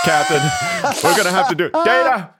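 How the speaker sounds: hammed-up surgery panic; panicked mock doctor. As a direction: shriek for the scalpel like a panicking TV medic. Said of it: "be like".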